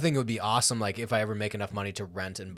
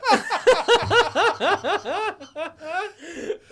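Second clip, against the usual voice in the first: long high laughter; 10 dB louder than usual. Real, not acted. Laughs at the story.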